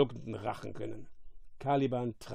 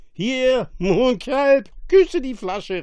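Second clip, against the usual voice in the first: loud, high boisterous command; calling out; 10 semitones higher, 10 dB louder, much slower than usual. A narrator's reading. Drunkard offering the bottle to the monster, calling out. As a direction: holler the order at the monster. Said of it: "rief er".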